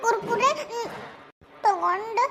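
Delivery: halting, tearful confession; slow broken phrases with long sobbing pauses; crying. Acted. Child confesses taking money to father, crying. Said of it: "ಯಾಕ್ ಅಳಕತ್ತೀವ".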